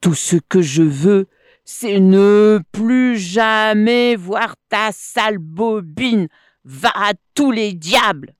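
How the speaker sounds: loud, emphatic telling-off; blunt anger. A narrator's reading. Monkey wants rid of the crocodile, blunt anger. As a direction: send him packing, leaning hard on the words. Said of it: "répondit Plamuseau sans mâcher ses mots".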